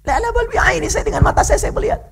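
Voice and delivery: high, eager protest; insistent excited child. Retold or imitated. Young Bukhari telling his doubting mother he sees with his eyes, high excited. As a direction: insist high and breathless with joy.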